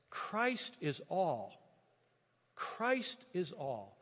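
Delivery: slow, weighty quote of Scripture; solemn. Real, not acted. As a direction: let each word land slowly. Paul quoted, slow solemn repetition.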